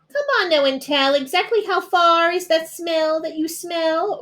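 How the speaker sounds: high, pushy rabbit voice; demanding rabbit. A narrator's reading. Rabbit pressing the bear for how far. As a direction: press him for details.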